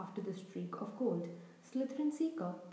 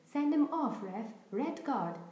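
yelling; 3 semitones higher, 4 dB louder than usual. Acted. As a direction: shout at the referee, a touch hammed-up. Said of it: "Dean Thomas was yelling".

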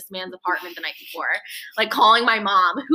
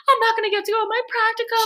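much higher, fast shrieking wail; hysterical screaming; 13 semitones higher, faster than usual. Retold or imitated. Driving to the man's apartment, shrieking hysterically at friends. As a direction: reenact the hysterical scream in the car. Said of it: "I am screaming at the top of my lungs".

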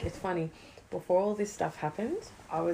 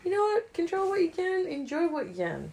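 much higher, bright and insistent; exuberant advice; 11 semitones higher, 4 dB louder than usual. Real, not acted. Urging his mother to enjoy now, exuberant. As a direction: preach it with a lift.

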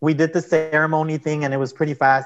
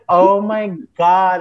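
slow, louder, higher "Oh my God" in a shifted voice; dismayed at the family finding out; mortified gasp; 5 semitones higher, 6 dB louder, much slower than usual. Retold or imitated. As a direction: drag it out, horrified and loud. Stunned reaction to likes and anger.

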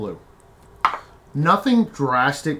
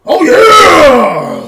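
huge hype shout, much higher; wrestler shout; 18 dB louder than usual. Acted. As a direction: roar it like a pro wrestler. Pro wrestler wizard reaction.

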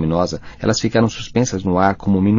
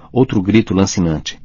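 usual speaking voice

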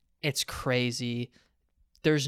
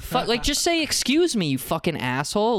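exasperated, high-pitched rant dropping at the insult; retold anger; 7 semitones higher than usual. At a guy who pushed into him, retold angry outburst. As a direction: replay an indignant outburst, starting high. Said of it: "I was so angry".

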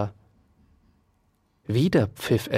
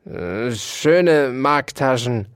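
slow, grumbling animal growl; slower than usual. A narrator's reading. Dauerspeck scoffs at the mouse's compliment, growling. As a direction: growl it scornfully, drawing it out. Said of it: "knurrte Ambrosius Dauerspeck".

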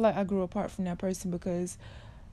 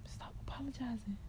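breathy whispered aside; 3 semitones higher, 12 dB quieter than usual. Real, not acted. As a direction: drop to a whisper. Closing advice, dropped to whisper.

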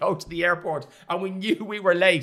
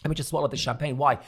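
ordinary talk, nothing marked

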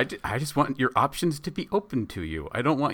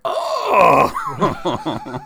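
high, loud comic "Oh!"; hammed-up shocked exclamation; mock shocked cry; 12 semitones higher, 7 dB louder than usual. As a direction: burst out in exaggerated shock. Retold shocked reaction to circumcision news.

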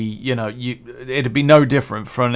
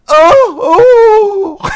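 high, loud shrieking "oh"; hammed-up shriek of a tennis player hitting the ball; imitating the tennis grunt; 11 dB louder than usual. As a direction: mimic the tennis player's squeal, much higher and louder. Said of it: "makes the most amazing noise ever".

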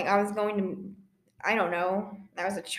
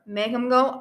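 ordinary talk

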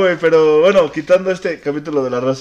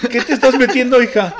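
high, louder laughing mom; mock mother voice; 9 semitones higher, 4 dB louder than usual. Mother questioning her daughter, hammed-up and laughing. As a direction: play the shocked mom, half laughing.

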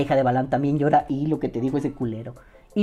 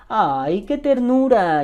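higher, slow, cooing ay-how-sweet; mock tenderness; 6 semitones higher, 3 dB louder, much slower than usual. Reacting to a sappy poem, mock cooing. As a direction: swoon over it with fake tenderness.

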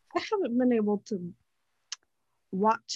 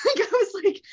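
laughing; 10 semitones higher, 4 dB louder than usual. Real, not acted. Laughing about weeping at movie.

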